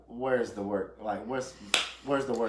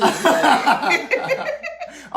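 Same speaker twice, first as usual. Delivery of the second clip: big laugh; 11 dB louder than usual. Real, not acted. Host cracks up again.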